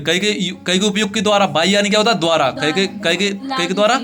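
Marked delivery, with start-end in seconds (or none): none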